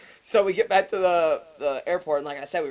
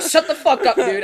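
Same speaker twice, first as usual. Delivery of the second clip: higher, louder, laughing disbelief; mock upset outburst; 9 semitones higher, 10 dB louder than usual. Friend hearing of the near miss, mock upset outburst. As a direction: blurt it, outraged but amused. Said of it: "I got so upset".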